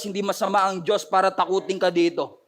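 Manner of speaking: normal talking voice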